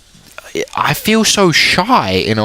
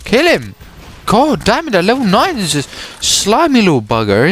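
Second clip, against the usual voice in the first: frustrated game yell; 8 semitones higher than usual. Real, not acted. Yelling at game.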